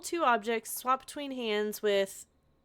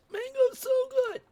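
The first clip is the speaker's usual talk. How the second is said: squeal; 3 dB louder than usual. Real, not acted. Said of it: "savoring mango".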